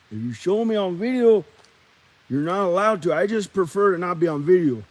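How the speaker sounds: lightly voiced Mexican homeboy; Mexican guy character. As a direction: object in a put-on Mexican accent. Same character keeps talking in skit.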